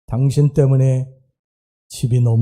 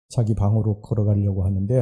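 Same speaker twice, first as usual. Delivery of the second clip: usual speaking voice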